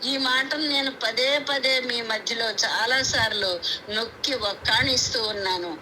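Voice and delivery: ordinary talk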